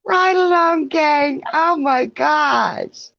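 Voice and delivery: high, drawn-out delighted welcome; gushing, stretched-out hello to arriving friends; excited greeting. Real, not acted. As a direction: call out in high-pitched, thrilled surprise.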